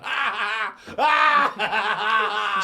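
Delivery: put-on high, loud drunken howls; drunk screaming. Drunk recruit howling in the barracks. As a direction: wail it out like a drunk who won't sleep.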